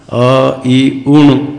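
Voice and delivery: अ, ई, उण one at a time, clear final ण; correct separated vowels. Shown as a demonstration. Teacher modelling for pupils, अ, ई, उण carefully separated. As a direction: hold each vowel apart up to उण. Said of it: "कैसे बोलना?".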